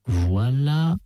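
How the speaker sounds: s'écrie, un peu plus haut et plus fort; exclamation vive. A narrator's reading. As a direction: annonce vivement l'arrivée. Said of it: "s'écria-t-elle". (s'écriant avec entrain)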